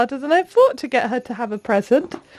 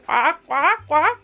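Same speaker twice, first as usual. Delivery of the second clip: playful penguin quack; slower than usual. Pretend. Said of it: "do we walk like a penguin?".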